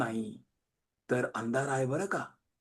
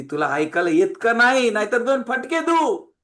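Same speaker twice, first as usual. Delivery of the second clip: loud scolding, climbing higher; imitated motherly telling-off; angry mother; 6 semitones higher, 7 dB louder than usual. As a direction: threaten the child as a fed-up mom. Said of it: "आई रागात येऊन बाहेर येते".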